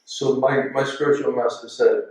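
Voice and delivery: ordinary talk, nothing marked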